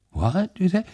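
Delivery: put-on high, startled old man; an old man who misheard; old Tag, baffled. A narrator's reading. Old Tag asks the hussar to repeat himself, high and confused.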